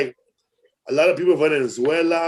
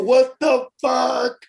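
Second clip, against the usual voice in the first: high, slow, drawn-out "what the fuck"; mock disbelief; 9 semitones higher, much slower than usual. Comics hearing Comedy Central news, high slow mock disbelief. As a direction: stretch it out in high mock shock.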